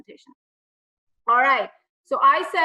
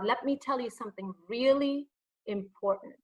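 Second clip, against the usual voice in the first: "really", a suspenseful gap, then a softer "important"; dramatic pause; 6 dB quieter than usual. Shown as a demonstration. Coach shows the audience a pause, held before "important". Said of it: "I'm just kinda creating that pause".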